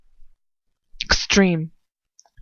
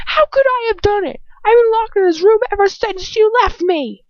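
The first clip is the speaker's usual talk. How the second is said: much higher, shrieked protest; screaming; 14 semitones higher, 5 dB louder than usual. Acted. Trapped girl screaming at the man who locked her up. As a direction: scream it in his face. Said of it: "I scream".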